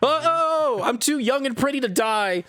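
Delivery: high-pitched retold panic cry; mock scream. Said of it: "she starts screaming".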